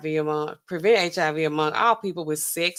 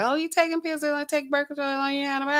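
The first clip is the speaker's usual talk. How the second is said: higher, lightly mocking voice of questioners; imitating surprised people; 8 semitones higher, 4 dB quieter, faster than usual. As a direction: mimic incredulous onlookers, pitched up. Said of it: "People like".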